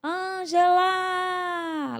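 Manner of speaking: slow, drawn-out high call from far away; distant calling voice. Retold or imitated. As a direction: stretch the name like a voice calling from far off. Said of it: "uma voz longe lá no fim dizia". (voz distante chamando, arrastada)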